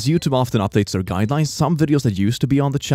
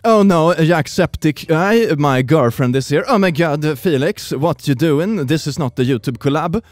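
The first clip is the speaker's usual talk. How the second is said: higher, put-on panicked lover; hammed-up dismay; mock fan-fiction drama; 4 semitones higher, 3 dB louder than usual. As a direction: act it like a cheesy soap scene.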